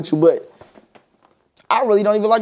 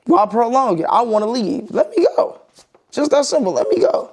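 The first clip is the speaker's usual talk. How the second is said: higher-pitched retelling of your own breakup line; retold breakup plea; 6 semitones higher than usual. Man retelling what he tells exes wanting closure, pitched-up insistence. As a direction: act out telling an ex it's over, voice riding high. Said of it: "I'm like".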